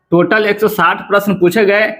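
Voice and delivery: ordinary talk, nothing marked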